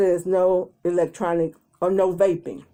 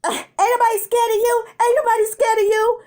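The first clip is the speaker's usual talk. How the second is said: high, loud taunting holler; 13 semitones higher, 4 dB louder than usual. Retold or imitated. Girl outside taunting from afar, high hollering. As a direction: yell the taunt while running off. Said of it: "she hollering".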